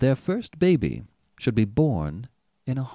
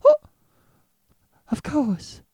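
high, panicked cry; a terrified nurse blurting; hysterical nurse; 4 dB louder, slower than usual. A narrator's reading. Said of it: "she cried hysterically".

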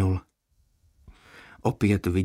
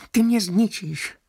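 lamenting quietly; 13 semitones higher, 5 dB louder, slower than usual. A narrator's reading. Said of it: "naříkal potichu".

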